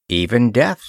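ordinary delivery, nothing marked

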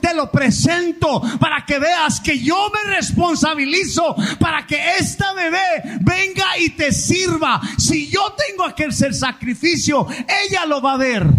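a hint of a parent praying, high and fervent; voicing a parent presenting the baby to God; parent's prayer. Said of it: "Usted está diciendo".

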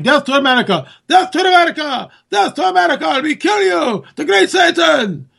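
high-pitched imitation rally chant; mock crowd yelling. Host imitating the crowd at a rally in Iran, mock yelling. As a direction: cry it out like a chanting mob. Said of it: "the crowd can be yelling".

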